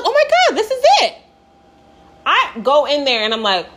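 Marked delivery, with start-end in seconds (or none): 0.0-1.1 high, acted burst of hope; excited. Lost hiker thinking she found the trail, high and excited. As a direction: replay the thrill of spotting the way out. Acts hopeful discovery of trail.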